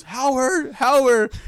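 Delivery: high, loud imitation of someone hollering; top-of-lungs yell. Retelling a man yelling at the wrong guy, loud yell. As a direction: yell the name out like calling across a carpet. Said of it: "he's yelling out from the top of his lungs".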